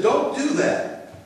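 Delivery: high-pitched upset plea, acted out; mock-distressed, pitched well up; upset child. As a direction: imitate an upset grown child pleading. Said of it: "My children get upset. They'll cry".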